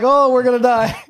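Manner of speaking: high, loud comic wail of dread; hammed-up fearful cry; mock panic. Acting out the terrified friends.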